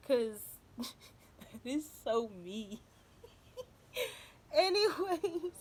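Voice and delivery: laughing as the tears come, voice breaking; emotional laughing through tears. Real, not acted. Telling viewers about Becky the goose, laughing and tearful. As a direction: laugh it off while choking up. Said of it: "this is getting me emotional".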